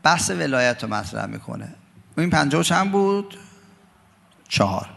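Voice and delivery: usual speaking voice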